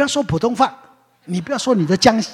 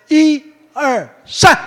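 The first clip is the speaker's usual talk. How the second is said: a loud slow countdown to start; loud slow count-in; 7 semitones higher, 7 dB louder, much slower than usual. Real, not acted. Preacher counting the congregation in, loud and slow. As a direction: count one two three slowly and loudly. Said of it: "好，我们来说".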